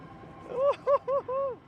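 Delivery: breathless thrilled laugh; laughing on the drop; excited laughter. Real, not acted. Laughing on ride.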